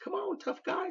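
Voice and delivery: daring the sergeant to repeat the slur, a touch of a jeer; mock-taunting challenge. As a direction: dare him, lightly taunting.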